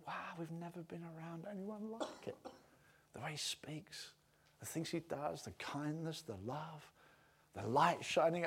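very soft, awed murmur; hushed wonder. Acted. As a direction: marvel under your breath. Enemies marvel at Jesus.

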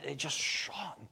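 a hushed, unvoiced whisper; awed whisper. A narrator's reading. Preacher describing the glowing cloud to his congregation, in an awed whisper. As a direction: breathe it out in wonder.

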